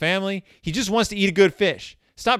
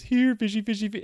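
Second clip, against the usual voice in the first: calling fish; 6 semitones higher than usual. Retold or imitated. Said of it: "Ernie was".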